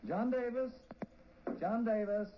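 clerk calls the witness aloud; calling out. Acted. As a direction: call the name across the courtroom.

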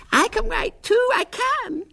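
put-on very high, lisping plaintive child; squeaky, plaintive insistence; plaintive little girl. A narrator's reading. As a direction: plead shrilly as a six-year-old near tears. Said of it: "she said plaintively".

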